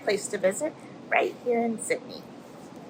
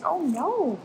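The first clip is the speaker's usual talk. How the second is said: higher, lightly hammed-up "oh no"; mock alarm; 7 semitones higher than usual. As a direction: play the octopus's fright for kids. Octopus chased by shark.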